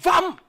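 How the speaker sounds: loud high engine roar; vam car noise. Acted. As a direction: imitate a speeding car.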